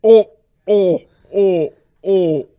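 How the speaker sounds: loud alien gibberish; Jabba the Hutt voice. Retold or imitated. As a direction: bellow it like Jabba. Jabba imitation.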